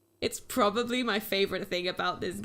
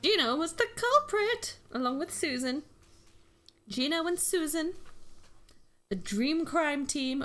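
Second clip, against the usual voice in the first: triumphant reveal; 7 semitones higher than usual. Acted. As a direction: announce the culprits with glee.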